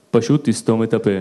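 lightly voiced father, low pitch; father's curt answer. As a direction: drop low to play dad's blunt reply. (אבא עונה קצרות, בטון נמוך)

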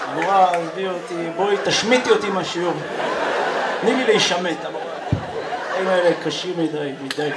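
a hint of a kid whining to his teacher; whiny schoolkid. Kid begging the teacher to let him skip class, lightly whiny.

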